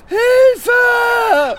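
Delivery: very high, loud cry for help; shrieking for help; panicked scream. Acted. Panics about ants.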